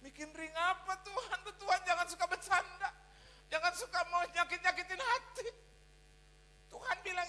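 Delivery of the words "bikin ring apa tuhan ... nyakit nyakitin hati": louder, laughing in disbelief; laughing protest. Retold or imitated. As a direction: laugh it off, don't tease me Lord. (protes sambil tertawa tak percaya)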